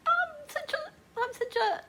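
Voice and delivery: high, choked-up whimper; weepy, high, unfinished attempt to explain; crying. Real, not acted. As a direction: try to speak through tears. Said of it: "you know, I cry".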